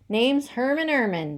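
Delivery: smooth, sly introduction with a smile; a smiling con man introducing himself. A narrator's reading. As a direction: slip the name out slyly, smiling. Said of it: "the weasel said with a sly smile".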